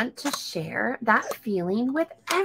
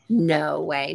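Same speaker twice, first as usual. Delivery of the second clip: ordinary delivery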